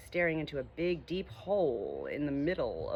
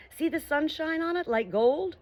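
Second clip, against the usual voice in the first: excited call; 9 semitones higher than usual. Acted. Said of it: "crying out at the top of his voice".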